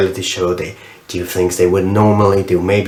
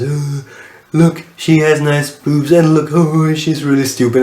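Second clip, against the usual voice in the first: put-on, higher mocking voice; 5 semitones higher than usual. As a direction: mimic someone gawking and sneering. Mocking the joke of such shows.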